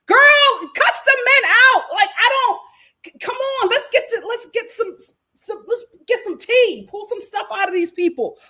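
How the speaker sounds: high, loud outburst easing into urging; worked-up, high-pitched gossip rant; exasperated rant. Acted. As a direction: egg her on at the top of your voice. Ranting at the crying host.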